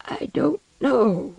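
high, slow whimpering plea; feeble, distressed denial; weak sobbing. A narrator's reading. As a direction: drag the words out tearfully, pitched high. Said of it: "sobbing with weakness".

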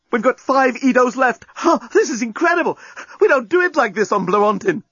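high, astonished exclaiming; excited amazement. A narrator's reading. Alien journalist amazed by Earth lovemaking, excited. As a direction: marvel in a high voice.

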